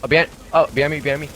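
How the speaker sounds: urgent callout. Real, not acted. Warning teammates of enemy behind.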